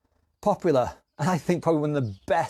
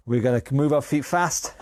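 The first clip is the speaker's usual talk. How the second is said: ordinary talk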